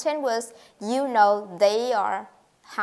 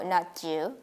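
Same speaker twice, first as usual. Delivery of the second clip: ordinary talk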